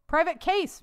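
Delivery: man hurrying outside to catch the private; mild yell. Acted. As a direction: call out to him as you come through the door. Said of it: "Mister Creed yelled".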